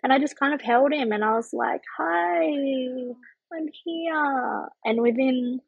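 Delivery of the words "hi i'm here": higher, drawn-out soft greeting; tender greeting. Retold or imitated. Mother greeting the newborn she is holding, tender and high. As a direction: coo it gently, letting the second part soften.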